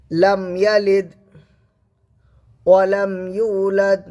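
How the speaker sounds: slow lifted recitation; measured recitation; Quran recitation. Shown as a demonstration. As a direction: recite it slowly and evenly.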